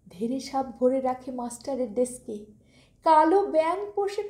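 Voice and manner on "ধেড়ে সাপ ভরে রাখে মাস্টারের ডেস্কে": ordinary delivery, nothing marked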